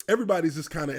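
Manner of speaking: normal talking voice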